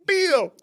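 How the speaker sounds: "Beal" called out high and loud, B for V; mispronounced veal. Retold or imitated. Filipino cook announcing lunch, high loud "Beal" with B for V. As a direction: shout "Beal" with a B in place of V. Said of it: "he couldn't pronounce veal".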